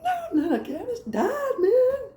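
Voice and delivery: very high, squeaky mock distress; hammed-up hysterical indignation; outraged retort. Acted retort after being shocked.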